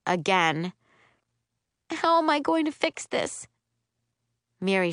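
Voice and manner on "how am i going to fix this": tearful. Acted. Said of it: "My eyes fill with tears".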